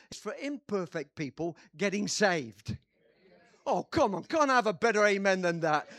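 loud, slowing, insistent pulpit voice; full-force preaching. Real, not acted. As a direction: drive it home to the whole church.

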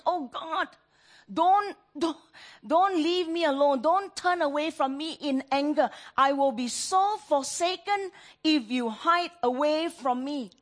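high, loud begging prayer; loud desperate plea. Acted. Preacher voicing the psalmist afraid of being forsaken, loud desperate plea. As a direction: plead as if about to be abandoned.

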